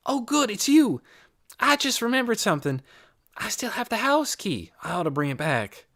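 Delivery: put-on high, quick-spoken girl; eager, high character voice; Amanda, hurried girl. A narrator's reading. Girl phoning about a house key, high and hurried.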